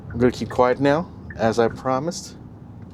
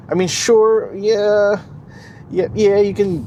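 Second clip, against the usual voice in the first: excited; 9 semitones higher, 5 dB louder, slower than usual. Real, not acted. Conceding in excited rush.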